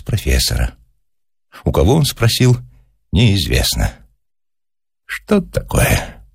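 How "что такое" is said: high-pitched, puzzled exclamation; baffled professor. A narrator's reading. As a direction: utter bewilderment, pitched well up. Professor baffled, voice in next room.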